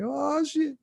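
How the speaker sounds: high shocked squeal. Retold or imitated. Teen repeating his shock about mom's weed, high squeal. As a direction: yelp it up high. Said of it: "I'm like".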